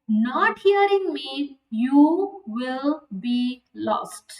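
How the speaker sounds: drawn out, very slow, each word weighed; preacher slowly warns the congregation, slow grave warning. Shown as a demonstration. Grave and slow.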